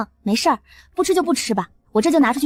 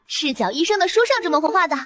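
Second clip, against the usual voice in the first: usual speaking voice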